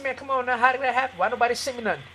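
high, light-voiced incredulous complaint; retold pleading protest. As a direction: plead ignorance to the officer.

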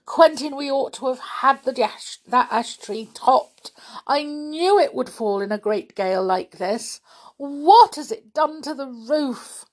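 fretful wail over what has happened; distressed. A narrator's reading. Aunt lamenting the fallen tree to husband, distressed. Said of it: "said poor Aunt Fanny, covering her eyes".

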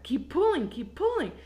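mock urgent coaching; imitated urging. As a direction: egg a brother on urgently. Retold urging of brother.

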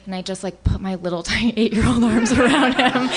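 normal talking voice

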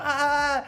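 short, high, loud shriek of "ah"; imitating mom's scream. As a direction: mimic a nightmare scream heard from the next room. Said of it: "se escuchaba de su cuarto un grito".

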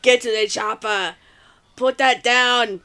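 low mock Austrian accent; Arnold impression. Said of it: "I wanna hear your Arnold".

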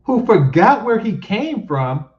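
high, loud indignant question; incredulous echo. Real, not acted. Commentator challenging what the woman said, loud incredulous echo. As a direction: throw her words back in disbelief. Indignantly echoing the clip's complaint.

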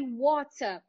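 "water" with a British crisp T; British accent. Shown as a demonstration. Teacher contrasting two accents for students, British pronunciation. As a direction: say "water" the British way, true T and open ending. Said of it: "ouve ali a britânica".

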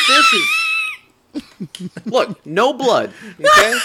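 high, loud mock yell, laughing through it; hammed-up high shout, cracking up; mock shout as wife. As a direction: playfully yell like a fed-up wife showing how it's done. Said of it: "She's like".

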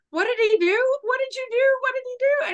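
high, frantic panicked questions; freaking out. Retold or imitated. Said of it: "freaking out".

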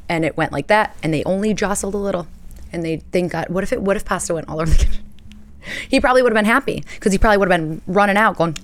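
normal talking voice

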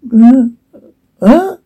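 two high, startled gasps; acted gasp.

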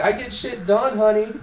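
high, sassy comeback. Retold or imitated. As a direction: give it full diva sass. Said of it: "I'm a ball of fucking sass".

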